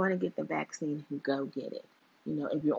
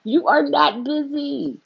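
louder, slow, stress on are; emphatic callout; 5 semitones higher, 8 dB louder, slower than usual. Real, not acted. Vlogger scolding her old boyfriend about being busy. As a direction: hammer each word at the ex. Repeated accusation to ex.

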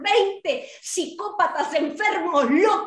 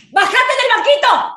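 higher, louder order; angry shout; 6 semitones higher, 4 dB louder than usual. Acted. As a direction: bark ¡Bájate del banquito! at full force. Repeats order, now shouting.

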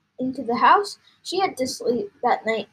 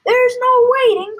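high, loud outburst; worked-up father crying out; impatient cry; 13 semitones higher, 9 dB louder than usual. A narrator's reading. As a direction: cut in with an excited shout. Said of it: "cried Mister Cranky, working himself".

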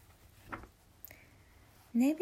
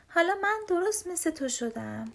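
higher-pitched storybook shout; excited cry; 6 semitones higher, 3 dB louder than usual. A narrator's reading. Little bear boasts to big brother while playing, mild excited shout. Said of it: "خرس کوچولو فریاد زد".

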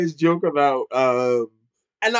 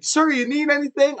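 high, polite flight attendant; an attentive, courteous attendant; 9 semitones higher than usual. Pretend. Flight attendant offers the angry grandfather a drink, high and polite.

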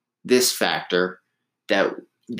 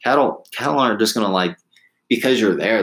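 usual speaking voice

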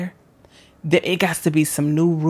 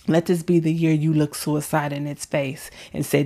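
ordinary delivery

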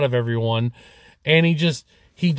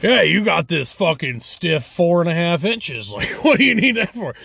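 mocking macho dude; 5 semitones higher than usual. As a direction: sneer it like a cocky guy. Mocking guys' macho attitude.

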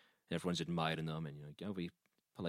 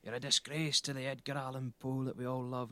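mock indignant heckle, raised pitch; booing crowd; 7 semitones higher than usual. Crowd boos the poet off stage, mildly outraged. Everyone starts booing him.

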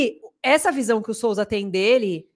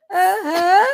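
high, loud, forced little aham through a laugh; strained fake yes; 9 semitones higher, 8 dB louder than usual. Wife imitating the exhausted husband's forced yes, high and loud. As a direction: fake enthusiasm while suffering.

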